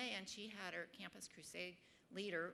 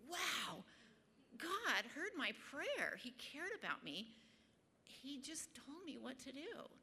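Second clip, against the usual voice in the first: awed wonder; 6 semitones higher, slower than usual. Retold or imitated. Awed at answered prayer.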